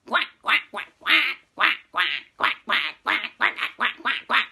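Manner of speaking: loud rapid quacking; loud duck quacking; duck quacks. Acted.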